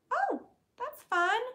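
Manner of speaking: high slow squeaky delight; high cute voice. Pretend. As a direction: squeal it like a cartoon. Put-on voice.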